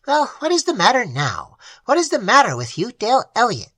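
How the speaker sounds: high, cold, mocking imp voice; cold little familiar. Familiar taunting its master Dale, high and cold. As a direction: sneer down at him from a tiny height. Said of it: "stared coldly up at him".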